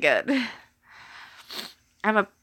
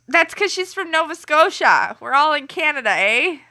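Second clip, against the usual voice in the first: put-on Canadian accent; 6 semitones higher, 3 dB louder than usual. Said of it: "mock my Canadian accent".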